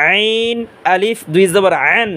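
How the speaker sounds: আইন squeezed in mid-throat, drawn out; slow recitation, constricted throat on আইন and আন. Shown as a demonstration. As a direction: tighten the pharynx for আইন, take it slowly. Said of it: "আইন উচ্চারণ হয় গলার মাঝখান".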